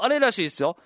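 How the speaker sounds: ordinary delivery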